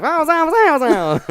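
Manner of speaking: very high, loud wows breaking into a laugh; mock baffled coworker. Host imitating a coworker's confused thoughts, laughing. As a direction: squeal wow like you understood nothing. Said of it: "he probably think I'm saying".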